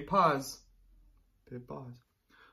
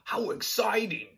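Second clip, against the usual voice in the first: higher excited squeal; 9 semitones higher, 4 dB louder than usual. Acted. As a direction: bubble over with delight. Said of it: "he giggled".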